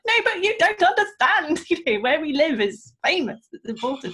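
high, insistent mock-child plea; indignant child protest. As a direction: re-enact an excited kid insisting, half laughing. Retold child protesting to unimpressed mother.

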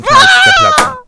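shouted sound-effect pop; bang. Acted. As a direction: burst out with the explosion. Egg explodes in the pan.

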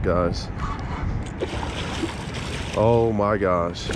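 ordinary talk

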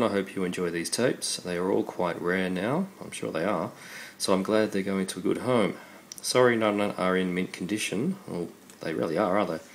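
ordinary talk

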